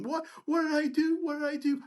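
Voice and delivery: high-pitched whimpering plea, imitated; mock crying. Cornered resident pleading in the hallway, mock crying. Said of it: "And he starts crying".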